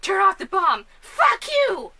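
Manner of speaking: mock defiance, climbing high on the insult; acted standoff. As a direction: play a hammed-up confrontation. Replays favourite standoff scene.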